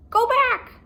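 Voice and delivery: very high, loud squawk; parrot voice. Acted. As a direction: screech it like a parrot. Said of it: "squawked Polly".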